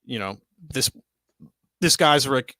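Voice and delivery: talking through hiccups; speech broken by hiccups. Real, not acted. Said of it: "I'm having the hiccups".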